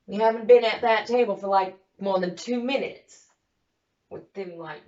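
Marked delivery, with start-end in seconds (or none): none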